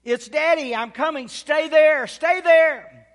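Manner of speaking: very loud, high urgent shouting; re-enacted frantic father's shout; retold yell. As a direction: holler down to a lost child, pushing harder on each phrase. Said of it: "I yell again".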